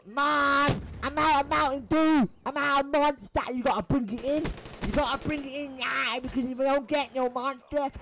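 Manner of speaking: put-on high, loud whiny gamer kid voice; mocking a greedy camper, high and whiny; spawn camper impression. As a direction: squeal like a spoiled kid out of energy drink. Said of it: "I just picture him sitting there".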